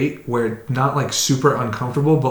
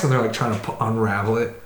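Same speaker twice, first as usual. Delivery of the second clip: normal talking voice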